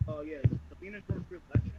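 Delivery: muffled, covered mic; speaking through a covered microphone; finger over the mic. Real, not acted. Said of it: "I think you're covering the mic again".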